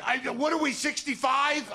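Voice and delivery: high yell. Retold or imitated. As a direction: fix the insult, still shouting. Correcting the comeback.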